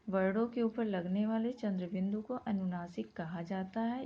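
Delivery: ordinary delivery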